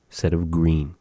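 ordinary talk

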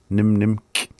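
"Nim nimk" with both nims complete; full double nim. Shown as a demonstration. Teacher shows it has nim twice, both sounded fully. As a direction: say "Nim nimk", keeping the m in each nim. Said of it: "Has the nim twice in there".

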